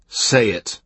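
ordinary delivery, nothing marked

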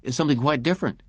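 sharply. A narrator's reading. Lowe correcting McLean, sharp and fast. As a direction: cut him off briskly. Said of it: "Lowe said sharply".

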